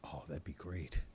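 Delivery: low, wishful whisper; real whispered wishing; hushed delight. Real, not acted. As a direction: whisper it, savoring the thought. Imagining the video on social media.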